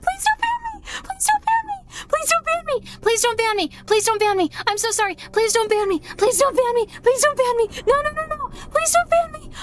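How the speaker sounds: very high, frantic pleading squeal; panicked squeal. Real, not acted. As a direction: squeak out the apology in real panic. Panic after streaming something not allowed.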